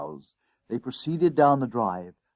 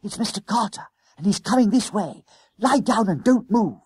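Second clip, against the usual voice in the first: high, tense schoolboy warning; urgent alarm; 11 semitones higher, 5 dB louder than usual. A narrator's reading. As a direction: warn your friend as danger approaches. Boys hide from approaching master.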